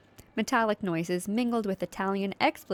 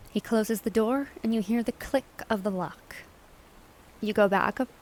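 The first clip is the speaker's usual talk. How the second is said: usual speaking voice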